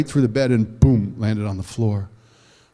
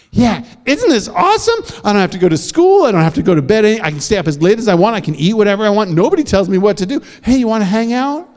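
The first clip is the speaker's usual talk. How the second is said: put-on high, fast, excited child; gleeful girl; 7 semitones higher, 4 dB louder, faster than usual. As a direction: brag about no rules.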